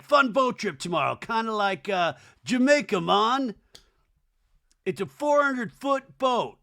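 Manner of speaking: hammed-up boat-trip bragging; mock hype. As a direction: pitch it up, playfully hyped. Acts Shep hyping the boat trip.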